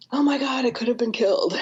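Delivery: high, alarmed mock panic; panicked client. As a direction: voice the client's frightened gasp of disbelief.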